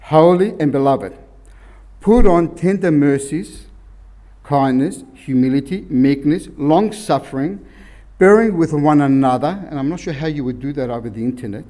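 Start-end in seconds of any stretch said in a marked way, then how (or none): 0.0-9.7 scripture reading. Acted. Reads Colossians aloud.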